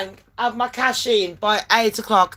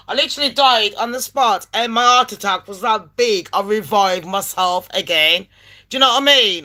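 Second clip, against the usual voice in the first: ordinary delivery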